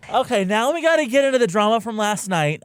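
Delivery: loud, high, eager kickoff; excited. Real, not acted. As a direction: jump in with big energy. Excited segue to drama.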